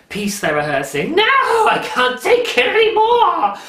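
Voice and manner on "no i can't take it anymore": high, loud, drawn-out overwrought wail; mock scream. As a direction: scream it in hammed-up despair. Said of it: "she didn't scream in the middle".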